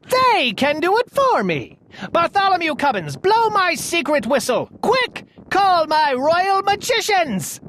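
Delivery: very high, eager royal commands; excited orders. A narrator's reading. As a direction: fire off orders like a gleeful king. King giving excited orders.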